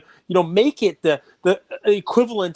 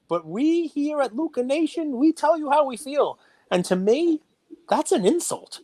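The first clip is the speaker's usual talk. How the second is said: mock host proclamation; 9 semitones higher than usual.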